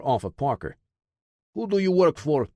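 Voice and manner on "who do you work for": interrogator in a lightly voiced Russian accent; cold Russian accent, pressing hard; thick Russian accent. A narrator's reading. Russian interrogator questions Ty, in a hint of Russian accent. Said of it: "in a thick Russian accent".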